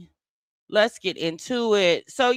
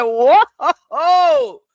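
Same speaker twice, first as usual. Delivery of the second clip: retold whoa; 6 semitones higher, 11 dB louder than usual. Reacting to the heated clip.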